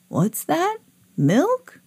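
put-on high, questioning old moon voice; puzzled moon mishearing; sleepy moon. A narrator's reading. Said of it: "replied the sleepish moon".

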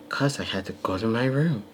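put-on breathy-sounding kid voice, lightly done; high soft child voice. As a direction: softly imitate the pouting kid, a touch high. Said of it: "You sound like [name]".

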